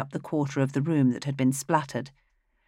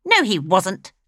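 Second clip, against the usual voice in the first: sharp, higher, louder retort; snapping; 5 semitones higher, 6 dB louder than usual. Acted. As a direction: cut him off sharply. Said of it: "snapped Flaminea".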